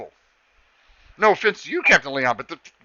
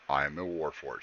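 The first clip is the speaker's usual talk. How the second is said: low, quiet deadpan robot; 14 semitones lower, 10 dB quieter than usual. Acted. Max answers her in a low, quiet robot voice. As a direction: play the Warforged flat and low. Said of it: "Max looks at her and goes".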